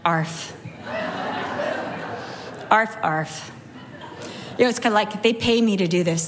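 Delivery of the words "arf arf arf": low, laughing, half-hearted arf; bored dog. Retold or imitated. Preacher voicing the lazy chihuahua, laughing, low. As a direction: bark like you can't be bothered.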